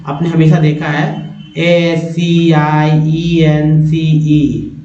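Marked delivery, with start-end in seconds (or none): 1.6-4.7 spelling letters slowly. Shown as a demonstration. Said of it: "एस सी आई को क्या पढ़ते हैं".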